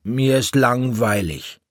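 put-on high child's whine of boredom, falling at the end; bored complaint. A narrator's reading. Bored child complains to his mother.

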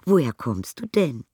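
high, delighted motherly voice; high, fond exclamation; Mrs Brown charmed. A narrator's reading. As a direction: coo over the little bear, pitched well up. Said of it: "sagte Misses Brown".

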